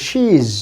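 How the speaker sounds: she is run together as a long "sheez"; long vowel. Shown as a demonstration. Teacher explains the colon means length, long vowel. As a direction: hold the ee in contracted she is. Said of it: "long sound".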